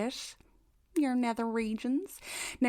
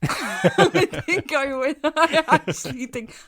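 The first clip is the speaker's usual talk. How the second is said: laughing hard through the words, louder; 4 semitones higher, 9 dB louder, slower than usual. Real, not acted. Cohost laughing off the host's roast, laughing. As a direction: let the laugh break up the words.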